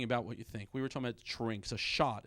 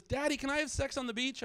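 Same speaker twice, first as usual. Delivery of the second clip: put-on high-pitched kid voice; son asking Dad; 12 semitones higher than usual. Son asks dad for a cocktail, kid voice. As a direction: ask like an innocent child. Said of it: "my oldest son came up".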